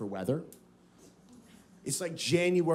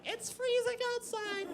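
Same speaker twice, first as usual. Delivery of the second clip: very high, slow mock whine; whiny drunk girl; 7 dB quieter than usual. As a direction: squeal it softly like a freezing party girl. Drunk girls complaining of cold.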